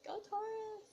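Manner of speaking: high, thin mock cheer; playful cheer. Cheering on her own star sign, playful high cheer.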